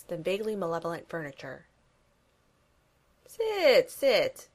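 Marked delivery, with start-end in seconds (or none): 3.3-4.5 put-on high accented villain; boomed villain accent; Gru voice. A narrator's reading. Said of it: "Gru says".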